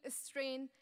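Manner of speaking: ordinary talk, nothing marked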